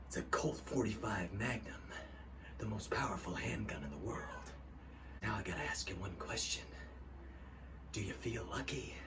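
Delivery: low, quiet menacing tough guy; low whispered threat, cool; gruff tough guy. Retold or imitated. Dirty Harry impression.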